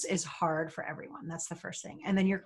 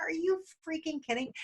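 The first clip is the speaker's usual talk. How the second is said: starstruck disbelief; 10 semitones higher, 5 dB quieter than usual. Retold or imitated. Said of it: "I don't tend to fangirl".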